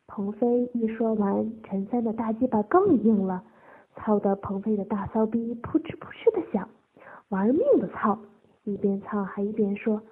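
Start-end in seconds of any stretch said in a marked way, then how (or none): none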